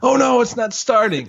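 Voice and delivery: high-pitched, laughing mock alarm; mock horror-movie panic. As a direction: imitate an old horror-film victim, amused. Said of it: "in all the old horror movies".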